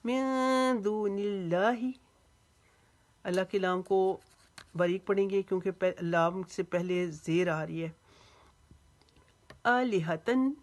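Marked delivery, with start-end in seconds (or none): 0.1-1.9 मिन held as a nasal hum before दूनिल्लाही; slow, higher मिन दूनिल्लाही, n hummed long; ikhfa. Shown as a demonstration. Teacher demonstrating ikhfa, nasal n of मिन sustained. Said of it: "एक अलिफ के जितना इफ़ा करेंगे". 9.7-10.6 आलिहतन higher, first vowel stretched; long आ. Shown as a demonstration. Teacher demonstrating madd, आलिहतन with long opening आ. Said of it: "आ को एक अलिफ जितना लंबा".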